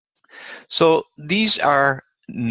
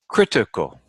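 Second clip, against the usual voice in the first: critical with CRIT stressed; critical, demonstrated with stress up front; stress on third-last syllable. Shown as a demonstration. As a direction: hit the first syllable of critical, then fall. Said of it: "third syllable from the end".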